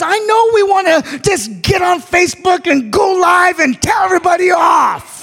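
sermon cadence building to a cry; full-force preaching. Acted. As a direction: preach it to the whole church.

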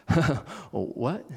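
soft, unsure, nervously laughing; a shaken little laugh, asking him to repeat. Retold or imitated. Peter nervously asking Jesus what he said. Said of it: "Peter sort of nervously laughing".

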